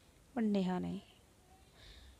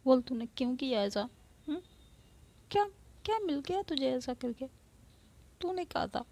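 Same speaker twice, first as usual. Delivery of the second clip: rising, pleading accusation; upset, accusing; 5 semitones higher than usual. Acted. Drunk Neha accusing her friend, upset and rising. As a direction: press her hard for an answer.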